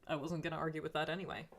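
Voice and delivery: ordinary talk, nothing marked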